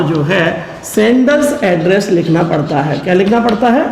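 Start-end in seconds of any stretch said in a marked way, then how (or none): none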